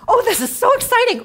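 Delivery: high, loud, hammed-up squeal of delight; mock squeal. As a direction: gush like a thrilled fan, tongue in cheek. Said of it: "this is where you squeal".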